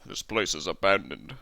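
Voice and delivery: low-pitched character voice; deep, understated figure voice; Gorath. Acted. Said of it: "oh, that's Gorath".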